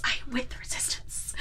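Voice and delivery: fluistert om zichzelf te overtuigen; unsure, whispered to himself; whispered self-convincing. Retold or imitated. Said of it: "trying to convince himself".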